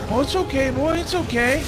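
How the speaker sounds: calm the spooked animal down; soothing a horse. Retold or imitated. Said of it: "That's them taming you".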